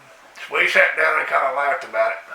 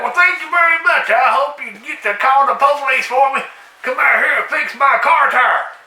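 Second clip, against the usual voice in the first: higher sarcastic thanks; sarcastic reply to the jogger's threat; 7 semitones higher than usual. Retold or imitated. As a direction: thank her with heavy sarcasm.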